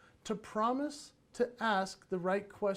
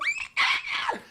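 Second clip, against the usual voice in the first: much higher, loud raptor shriek; raptor screech; 5 dB louder than usual. Pretend. Dad chasing his kids as the raptor, loud high shriek. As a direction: shriek like the Jurassic Park raptor. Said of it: "going a hundred percent with the raptor".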